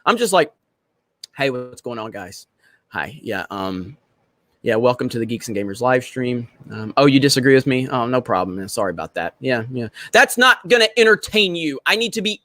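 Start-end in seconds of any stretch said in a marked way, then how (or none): 1.4-9.9 normal boring self. Retold or imitated. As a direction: mumble it out dull and flat-energy like a boring streamer. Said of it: "You know what normal [name] does?".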